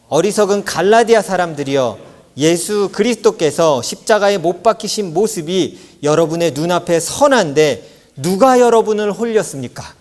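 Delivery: loud, agitated scripture declamation; impassioned reading. Shown as a demonstration. Leading the congregation in reading the verse, impassioned. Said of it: "격양된 어조로 같이 한번 읽어보도록".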